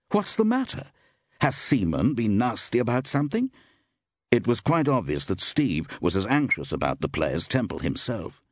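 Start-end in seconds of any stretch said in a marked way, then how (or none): 0.1-3.5 high, concerned, puzzled questions; surprised questioning. A narrator's reading. Steve asking the actress what went wrong, surprised. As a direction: press for the reason in surprise. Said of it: "cried Steve in obvious surprise".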